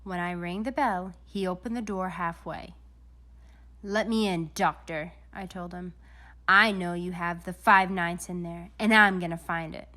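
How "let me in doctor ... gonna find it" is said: louder, insistent detective; firm demand. A narrator's reading. Detective confronts Doctor Brock at his door, loudly. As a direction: push in with force.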